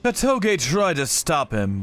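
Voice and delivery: ordinary delivery, nothing marked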